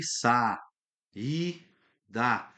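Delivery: "sa, i, da" with a gap after each syllable. Shown as a demonstration. Teacher showing how to separate syllables, slow and split up. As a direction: chant "sa, i, da" slowly, one syllable at a time. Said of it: "Fala a palavra devagarinho, vai cantando a palavra aí".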